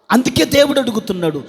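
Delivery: ordinary talk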